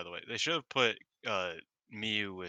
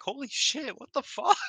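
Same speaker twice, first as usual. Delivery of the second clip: shocked; 6 dB louder than usual. Retold or imitated. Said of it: "I was like".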